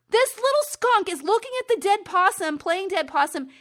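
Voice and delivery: high, loud, gushing disbelief; rising to a laugh at the awful picture; appalled excitement. Real, not acted. Horrified by the dead-possum page.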